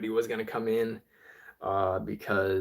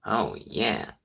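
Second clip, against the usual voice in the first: nerdy robot, nasal tone; 2 semitones lower, 4 dB louder, slower than usual. Shown as a demonstration. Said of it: "like a nerdy nasally robot".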